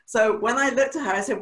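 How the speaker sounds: ordinary talk, nothing marked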